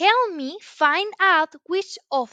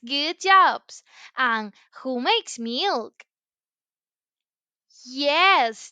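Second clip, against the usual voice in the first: ordinary delivery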